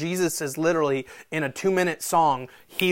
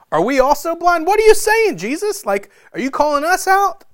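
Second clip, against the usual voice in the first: indignant Pharisees; 11 dB louder than usual. Acted.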